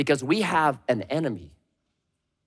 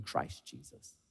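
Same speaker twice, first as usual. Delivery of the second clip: lower, much softer tender hush; reverent hush; 20 dB quieter, slower than usual. Real, not acted. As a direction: let the name land gently. Reverent soft close of verse.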